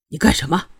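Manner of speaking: low, mildly angry challenge; somewhat angry. A narrator's reading. Prince challenging Shuangyue over the bucket of water, somewhat angry. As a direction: confront her with restrained annoyance. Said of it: "有些生气地说道".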